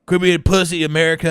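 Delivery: a hammed-up tough-guy shout; mock yell. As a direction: bark it out loud and high like a bully. Mock-yelling at America in a bit.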